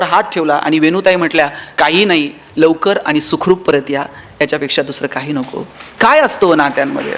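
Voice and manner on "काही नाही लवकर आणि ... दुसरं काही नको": gentle tender reply. Retold or imitated. Wife's loving reply to husband.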